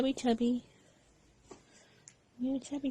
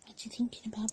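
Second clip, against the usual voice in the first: whisper; 5 dB quieter, faster than usual. Real, not acted. Mother whispers to baby.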